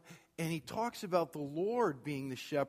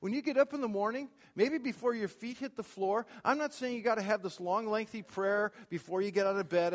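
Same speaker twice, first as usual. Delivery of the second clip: normal talking voice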